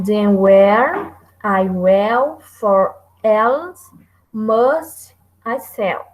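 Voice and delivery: very slow, drawn-out words; slow verse reading for scansion. Shown as a demonstration. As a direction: stretch each syllable to show the meter. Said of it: "digamos, devagar".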